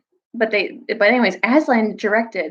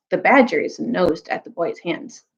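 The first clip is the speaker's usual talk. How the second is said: ordinary delivery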